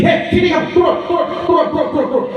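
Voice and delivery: high, repeated call; calling someone down. Acted. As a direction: order someone down in rapid repeats. (panggilan bernada tinggi menyuruh turun)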